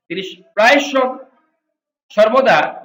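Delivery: ordinary delivery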